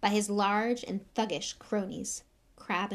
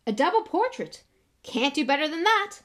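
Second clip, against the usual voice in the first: high, loud self-pleased celebrity; booming beaming enthusiasm; jovial showman; 7 semitones higher, 7 dB louder than usual. Acted. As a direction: announce it with showy delight. Lockhart beaming, showing off.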